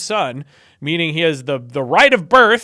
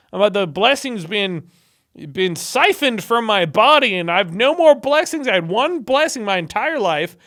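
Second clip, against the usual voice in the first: higher-pitched, emphatic mock protest; exasperated defense; 4 semitones higher than usual. Blind father imitated explaining his mistake to his son, exasperated. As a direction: plead your case, building in pitch and insistence.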